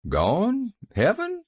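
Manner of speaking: high, dazed questioning; stupid surprise. A narrator's reading. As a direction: repeat it, stunned and slow-witted. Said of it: "he repeated stupidly".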